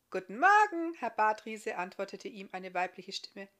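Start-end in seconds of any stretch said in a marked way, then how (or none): 0.1-1.8 higher, louder bright female reply; young woman answering. Acted. Iska answering the guest's greeting, high and friendly.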